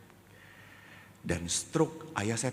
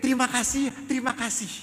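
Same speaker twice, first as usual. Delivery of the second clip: high-pitched mock child's cry, only moderately loud; child calling thanks; 5 dB louder, faster than usual. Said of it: "Dia terus teriak".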